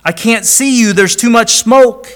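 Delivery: put-on boy trapped by the smoke crying out; frightened boy. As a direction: plead like a terrified little boy. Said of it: "The boy cried".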